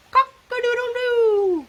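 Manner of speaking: high, loud rooster call; rooster crow. Acted. Reading the rooster's part to children, high loud crow.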